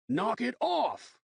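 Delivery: slow, high commanding shout. Acted. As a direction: bark it from the doorway.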